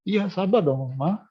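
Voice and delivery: mock a kid talking back to mom; snapping at parents. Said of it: "menjawab orang tua".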